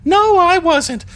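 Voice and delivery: very high, loud put-on female character voice; Dana voice. Captain answering as the questioned lieutenant, in Dana voice. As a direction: pitch it way up, loud and indignant. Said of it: "That's my Dana voice".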